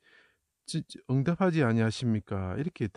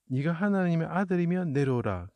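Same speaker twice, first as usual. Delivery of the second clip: jeering, pitched higher, taunting the one on the cross; higher mock jeer from the crowd; 6 semitones higher than usual. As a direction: throw up 하나님의 as a scornful challenge. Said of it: "하고 조롱하는 거죠".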